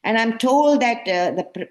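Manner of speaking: normal talking voice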